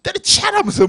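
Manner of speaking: high-pitched mock outburst; sudden jump up into a retold shout; imitated angry yell. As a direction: mimic a furious parent yelling. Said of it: "막 감지르고 화내고".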